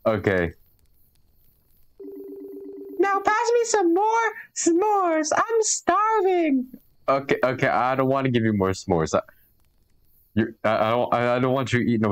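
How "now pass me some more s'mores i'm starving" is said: squeaky high drawn-out begging; high, slow cartoon voice. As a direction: stretch it out in a tiny high voice. Voicing a character asking for s'mores.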